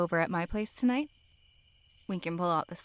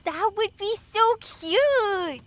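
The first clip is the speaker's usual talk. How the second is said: gushing squeal; 14 semitones higher, 7 dB louder, slower than usual. Acted. Gushing over dressed-up hot dog.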